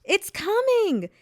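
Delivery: high, loud, drawn-out crowd cheer; excited cry. A narrator's reading. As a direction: cry it out slowly to the whole crowd. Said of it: "while others cried".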